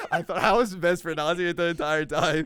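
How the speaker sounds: high, loud protest through laughter; helpless laughing disbelief; laughing hard. Real, not acted. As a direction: get it out while cracking up. Cracking up over the word.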